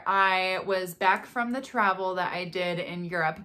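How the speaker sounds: ordinary talk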